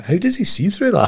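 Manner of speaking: high, baffled question. Retold or imitated. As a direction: ask it in amazed confusion. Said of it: "I went".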